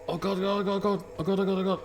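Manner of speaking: high, breathless oh god babble; frantic terrified muttering; terrified gibbering. Real, not acted. Chased, narrow escape.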